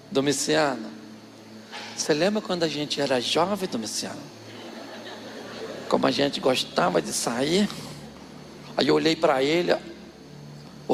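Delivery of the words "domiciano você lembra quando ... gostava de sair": lower, softer nostalgic coaxing; sweet-talking. Retold or imitated. Aunt softly coaxing husband about their youth. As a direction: charm him with memories. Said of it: "o tom de voz da minha tia mudou".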